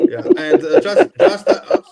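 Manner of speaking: high, loud, talking through laughter; laughing. Real, not acted. Panellist starts a comment amid shared laughter, laughing. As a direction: try to get words out while laughing. Trying to talk while laughing.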